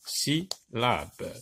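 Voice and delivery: usual speaking voice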